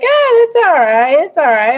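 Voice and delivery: high, sing-song imitation of a calm father; mock soothing dad. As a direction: imitate a relaxed, unbothered dad. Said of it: "He'd be like, he's like".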